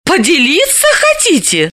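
high mocking sneer; sting him with it; venomous. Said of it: "ядовито осведомилась Далила".

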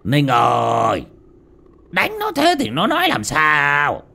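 put-on drawn-out call of an old master; an old father calling across the yard, slow; old man calling out. A narrator's reading. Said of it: "mới gọi".

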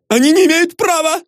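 very high, indignant squeal; indignant, panicky high voice; crying out in protest. A narrator's reading. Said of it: "закричал Изнурёнков".

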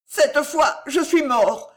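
high, loud wail of doom; acted terrified lament; wailing moan. A narrator's reading. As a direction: moan in panic before fainting. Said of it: "gémit Gillot".

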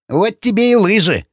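high-pitched disgruntled jibe; grumbling retort. Acted. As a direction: grumble an I-told-you-so. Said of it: "проворчал Сквернослов". (ворчливо, высоким голосом)

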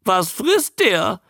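high creature squawk; high, drawn-out newt croak; talking newt. A narrator's reading. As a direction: parrot a visitor's question in newt voice. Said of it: "stieß der Molch hervor".

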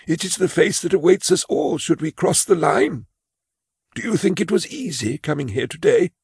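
put-on high, timid old clerk voice; a frail old clerk confessing his fear; old man Imus. Imus explains coming to report.

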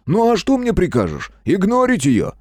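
high-pitched, exasperated appeal; worked-up rhetorical question; indignant protest. A narrator's reading. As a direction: protest as if unfairly blamed. Геныч keeps protesting in the quarrel.

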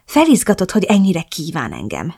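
plain reading voice

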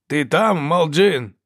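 high, loud probing call. A narrator's reading. Hunter seeks hidden Ferro, calling out. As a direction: call into the bushes.

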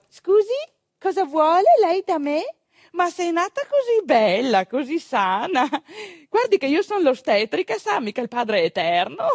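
a spirited midwife talking back; Gilda retorting. Retold or imitated. Gilda answers the father who wanted a boy, cheeky and sharp.

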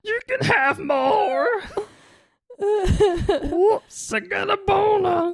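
high, slow whiny dinosaur; Carl the dinosaur. Pretend. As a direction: apologise like a clumsy dumb dinosaur. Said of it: "it's Carl, man".

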